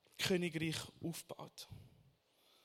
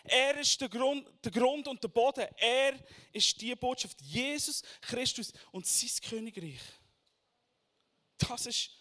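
forceful preaching, higher and louder; 6 semitones higher, 8 dB louder than usual. Acted. Preacher proclaiming to the congregation, forceful preaching.

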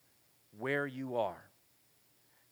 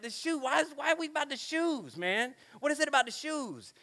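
exasperated dad; 9 semitones higher than usual. Retold or imitated. Dad mocking son's shoe obsession.

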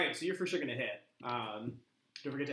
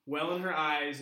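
usual speaking voice

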